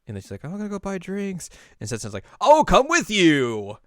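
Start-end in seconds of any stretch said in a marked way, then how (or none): 0.4-1.5 put-on high-pitched girl character, lightly sung out. Voices Aimu announcing errand. 2.4-3.8 loud, high, drawn-out shout; overeager offer. Retold or imitated. Setsuna eagerly volunteering.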